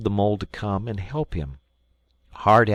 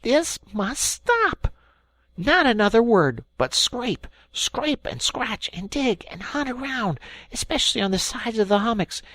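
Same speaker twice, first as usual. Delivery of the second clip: angry; 13 semitones higher than usual. Acted. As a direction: bite off each word. Rat angry continues.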